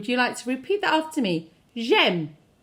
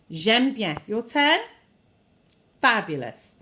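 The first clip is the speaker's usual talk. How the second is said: ordinary delivery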